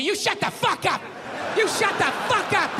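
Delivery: snapping "shut up" at him; hammed-up feminist telling him off; mock angry yelling. Feminists yelling at first male first lady.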